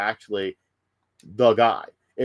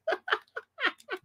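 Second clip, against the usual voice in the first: shrill burst of real laughter, much higher; high cackle; 8 dB quieter than usual. Real, not acted. Streamer cracks up at the Sam Hyde promo. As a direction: cackle helplessly at the joke.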